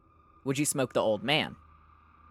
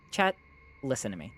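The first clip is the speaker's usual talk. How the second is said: usual speaking voice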